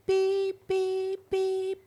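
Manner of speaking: high mock truck beep; imitated beeping. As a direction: beep like a reversing tow truck.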